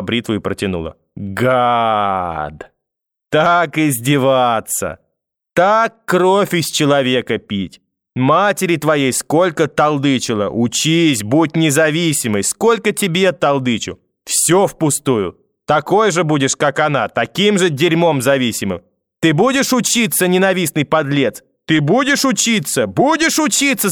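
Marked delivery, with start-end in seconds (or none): 1.2-18.8 high, slow, drawn-out reproaches; angry scolding. A narrator's reading. Grandmother berating grandson over homework, bitterly scolding. 19.2-23.9 very high, hysterical yelling; screaming demand. A narrator's reading. Grandmother demanding grandson study, screaming at him. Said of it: "закричала вдруг бабушка во весь голос".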